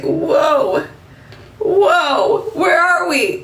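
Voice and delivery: high, confused double whoa, then a question; sudden bewilderment, voice jumping up; startled whoa. Retold or imitated. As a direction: reenact coming to in a strange car, bewildered. Waking up suddenly in a car.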